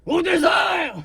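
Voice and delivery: high, loud cry; shouting. Acted. Prophet answers where he is going, shouting. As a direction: shout it out as a proclamation. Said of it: "c'est pas nécessaire de crier".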